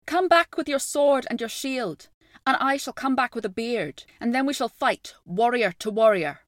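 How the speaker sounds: calling out loud and high; shouted challenge. A narrator's reading. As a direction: shout it across the river. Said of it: "a shout came to him".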